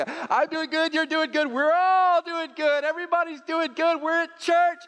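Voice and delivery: mock everyone insisting they're fine; fake-cheery churchgoer. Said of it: "that kind of thing".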